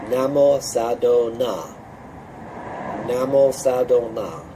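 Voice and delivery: slow, careful Namo Sado Na; teacher sounding out Namo Sado Na slowly; slow syllable demo. Shown as a demonstration. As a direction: spell out each syllable slowly. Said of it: "let's go through this syllable by syllable".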